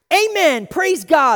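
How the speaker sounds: very high, loud jubilant cry; triumphant kid. Retold or imitated. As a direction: shout it like a child who just won.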